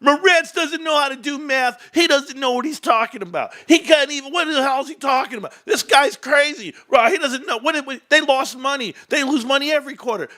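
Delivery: mimicking CPAs ridiculing him, whiny and high; mocking accountants. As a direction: sneer like a know-it-all accountant. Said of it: "all you C P As on the meltdown".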